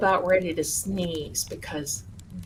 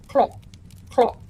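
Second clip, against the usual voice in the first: short clicking cluck imitation; raven cluck; 4 dB louder than usual. Shown as a demonstration. Teaching the chat a raven's call, a clicked cluck. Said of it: "A raven will go cluck".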